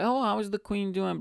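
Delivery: high-pitched mock question; joking imitation. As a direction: ask it in a high, teasing voice. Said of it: "as a joke".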